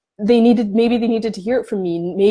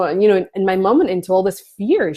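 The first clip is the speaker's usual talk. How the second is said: usual speaking voice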